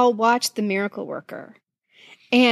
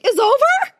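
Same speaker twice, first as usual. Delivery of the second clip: high, louder startled question; shocked disbelief; 12 semitones higher, 4 dB louder than usual. Retold or imitated. Remembering the credits rolling too soon, high stunned question. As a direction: sound stunned the film just stopped. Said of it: "and I was like".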